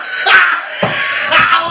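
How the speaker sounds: loud helpless laughter; real breathless laughing fit; hard laughing. Real, not acted. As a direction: laugh until you can't go on. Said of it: "I'm laughing so fucking hard".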